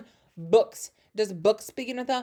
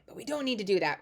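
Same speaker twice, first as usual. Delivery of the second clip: normal talking voice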